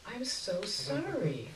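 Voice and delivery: soft, slow, quiet sympathy; sympathetic. Acted. Sympathetic reply to bad news.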